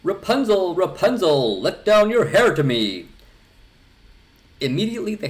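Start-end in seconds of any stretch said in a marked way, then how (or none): 0.0-3.1 high, loud call up to the tower. A narrator's reading. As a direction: call up as loud as you can. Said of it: "he went to the tower and cried".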